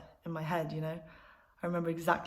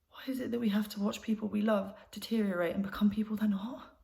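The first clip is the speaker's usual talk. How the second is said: higher-pitched, anguished plea to understand; distressed questioning; 4 semitones higher than usual. Acted. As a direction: ask in helpless despair. Despairing questions about grandmother.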